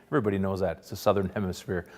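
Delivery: usual speaking voice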